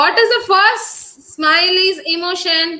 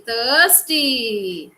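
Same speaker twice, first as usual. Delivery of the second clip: ordinary talk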